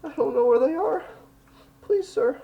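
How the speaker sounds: put-on high-pitched, pleading small child voice; crying little girl. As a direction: play a frightened little girl begging a stranger for help. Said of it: "she's just crying and".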